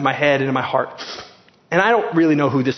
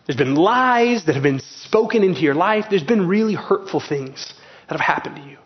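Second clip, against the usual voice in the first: usual speaking voice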